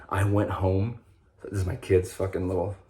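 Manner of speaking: ordinary delivery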